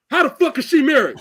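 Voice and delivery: loud, high-pitched mock outrage; hammed-up shouted disbelief; comedian's roast. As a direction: yell it in incredulous outrage. Imitates comedian roasting women.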